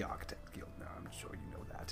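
whispering. Acted. Whispered aside in character.

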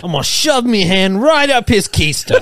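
bad Australian accent. Finishing the bit amid laughter, exaggerated Australian accent. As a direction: play up a clumsy Australian accent. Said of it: "I do it, and it sounds so bad".

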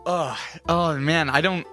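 ordinary talk, nothing marked

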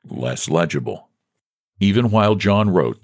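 ordinary delivery

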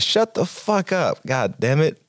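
high, slow irritated yell; annoyed snap. Acted. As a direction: tell them off, fed up. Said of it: "He's like".

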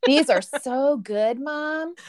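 mock kid voice. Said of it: "kids voiced praising food".

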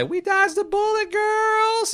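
very high, loud shrieking cheer; Arlene shriek. Retold or imitated. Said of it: "Or as she would say".

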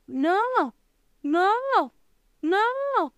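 high squeaky piglet refusal. A narrator's reading. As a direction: squeak it out like a little pig. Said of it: "El cerdito del medio dijo". (cerdito con voz aguda)

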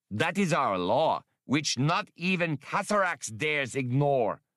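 put-on a proud old bull laying down the law; old sea bull Shazin. A narrator's reading. Old bull to Han, insisting on boarding at the dock, high and commanding.

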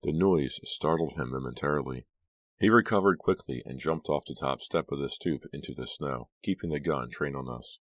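plain reading voice

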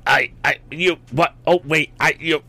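high broken sputters; spluttering in rage. A narrator's reading. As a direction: choke on words in fury. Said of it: "spluttered the bully, almost speechless with rage".

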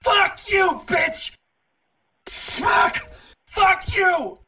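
high raging cries of fuck you; furious screaming. Acted. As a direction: scream in rage, near tears. Screaming at the phone operator.

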